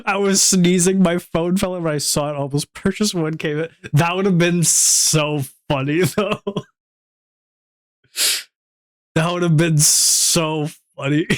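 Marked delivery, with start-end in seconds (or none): 3.9-11.3 drawn-out words breaking into laughter; real amusement. Real, not acted. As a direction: stretch it out while cracking up. Amused by a near mishap.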